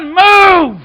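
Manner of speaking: loud, soaring preacher's shout; full-force preaching. Acted. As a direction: preach it, lifting the crowd. Preaching run resumes.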